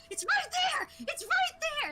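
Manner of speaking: put-on very high, loud frustrated little-kid shout; shouting toddler. Imitating a two-year-old shouting at Dora, frustrated shout. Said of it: "he'd be shouting at Dora".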